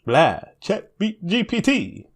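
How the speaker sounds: high, slow, stretched cartoon vampire; mock vampire. Co-host joking about ChatGPT as a vampire, high and drawn out. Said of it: "we were talking about vampires".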